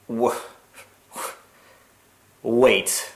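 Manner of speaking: normal talking voice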